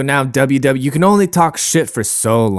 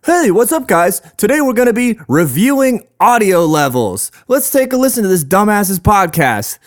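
much higher, mock peppy intro voice; reaction YouTuber; 7 semitones higher than usual. Podcaster mocking his own audio levels, high mock YouTuber voice. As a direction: send up a reaction YouTuber's opening. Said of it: "without somebody going".

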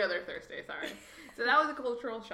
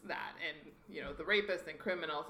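ordinary delivery

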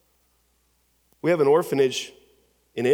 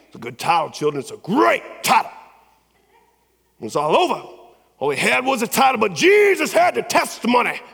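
impersonating a fiery preacher; 10 semitones higher, 6 dB louder than usual. Retold or imitated. Pastor contrasting Pharaoh's title with Moses' testimony, shouted preaching. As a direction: build each title line up to a cry. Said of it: "He goes".

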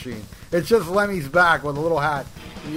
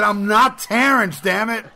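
louder, higher, slow protest; mock indignant protest; 5 semitones higher, 5 dB louder, slower than usual. Punched fan protests to security guy, mock loud indignation. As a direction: insist loudly you're not Terrence.

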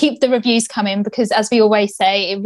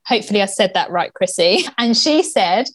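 ordinary delivery

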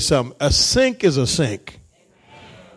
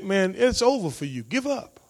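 much softer, insinuating aside; hushed tempter; 10 dB quieter than usual. Acted. Satan getting you to think it's over, hushed. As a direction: drop right down as if planting the thought. Voices Satan's discouraging suggestion.

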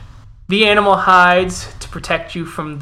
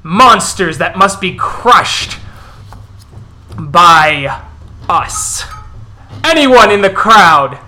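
furious wolf elder; 6 dB louder than usual. Acted. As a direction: thunder at the crowd as the wolf chief. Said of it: "as the Elder goes".

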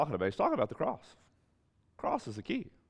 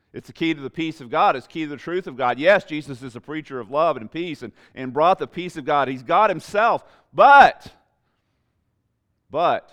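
louder, rising preacher's fervour; full-force preaching; 4 semitones higher, 10 dB louder than usual. Acted. Preacher driving home the cross to his congregation, fervent and loud. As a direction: build it up like a revival sermon.

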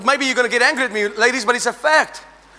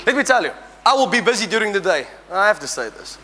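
ordinary talk

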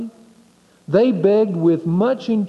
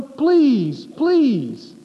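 higher, louder earnest pleading; begging please; 6 semitones higher, 3 dB louder than usual. Retold or imitated. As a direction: plead with outstretched hands. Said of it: "they're going".

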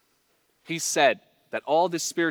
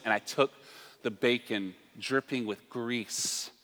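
slow, lower, drawn-out storytelling; teasing dog owner; 5 semitones lower, 8 dB quieter than usual. Acted. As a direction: tease the dog, lingering on each word. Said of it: "then the owner goes".

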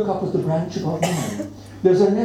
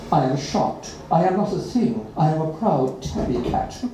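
plain reading voice

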